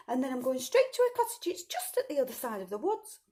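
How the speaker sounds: put-on little girl voice. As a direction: chirp it like an innocent child.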